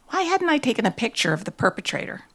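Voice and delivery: usual speaking voice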